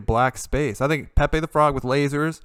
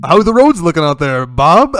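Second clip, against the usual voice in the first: radio host; 3 semitones higher, 10 dB louder than usual. Pretend. Radio host calls traffic reporter.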